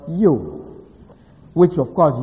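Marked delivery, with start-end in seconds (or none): 0.1-0.8 "you" set apart and drawn out; a weighted, falling "you"; stress on you. Shown as a demonstration. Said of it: "Hebrew puts emphasis on the word".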